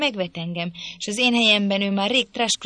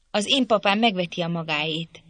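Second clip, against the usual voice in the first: plain reading voice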